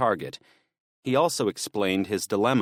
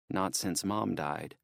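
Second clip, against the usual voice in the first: plain reading voice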